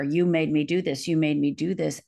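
normal talking voice

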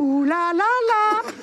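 long, very high and loud ouh là là là; overwhelmed disbelief, drawn out; high amazed exclaim. Retold or imitated. Singer stunned by sudden fame, long high loud exclaim.

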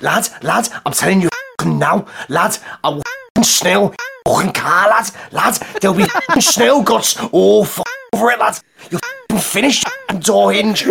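ranting Scouse driver, pitch climbing; Scouse accent. Acted. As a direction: threaten someone in a thick Scouse accent. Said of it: "impression of the man getting out of the car".

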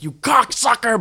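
high-pitched imitation of an angry manager; hammed-up exasperated outburst; mock furious swearing. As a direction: curse out the kid, voice leaping up. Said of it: "that was her max one".

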